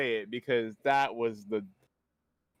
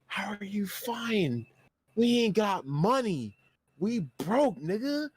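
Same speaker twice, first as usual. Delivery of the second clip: pleading reply; 8 semitones higher than usual. Retold or imitated. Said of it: "I'm like".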